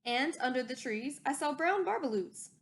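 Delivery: ordinary delivery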